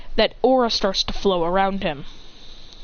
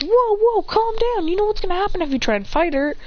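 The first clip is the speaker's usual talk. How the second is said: high, urgent plea to calm down; alarmed friend; 10 semitones higher than usual. Acted. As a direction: hold him back, pitched way up. Friend restraining him.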